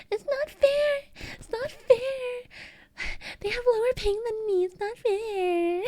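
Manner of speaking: put-on very high, drawn-out childish whine; whiny gaming meltdown. Streamer acts out losing a Valorant fight, whining very high. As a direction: wail it like a kid losing a match. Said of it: "I get very emotional".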